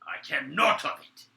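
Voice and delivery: a loud high defiant cry; angry outburst. Acted. Guard snapping at his fellow plotter, loud. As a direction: burst out in anger.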